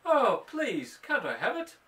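high, begging cat voice; pleading cat voice. Acted. Mother voicing the cat begging, high and pleading. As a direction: whine for a treat like a cat. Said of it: "And she can say".

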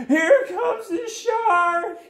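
very high, drawn-out alarm cry; hammed-up falsetto shriek, slow and loud; floundering panic. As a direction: wail the warning like a panicking little fish. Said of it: "The flounders were floundering".

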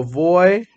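voy said slowly on its own, the oi drawn out; first syllable only. Shown as a demonstration. Teacher modelling how to split voyage for students. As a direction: stretch voy so the oi sound is heard. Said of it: "I hear the oya in the first syllable".

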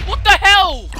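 very loud, high scream of disbelief; outraged yell. Real, not acted. Gamer shocked by a sudden death, outraged yell. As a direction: yell it at the top of your voice. Player killed again by mob.

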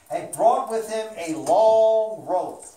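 ordinary delivery, nothing marked

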